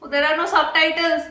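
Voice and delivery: normal talking voice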